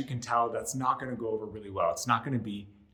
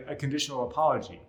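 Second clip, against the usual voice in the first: ordinary delivery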